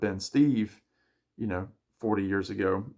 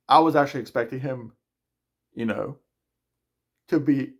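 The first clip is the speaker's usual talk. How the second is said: speech stretched by a yawn; 4 semitones higher, 4 dB louder than usual. Real, not acted. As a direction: fight off a yawn mid-sentence. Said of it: "still yawning".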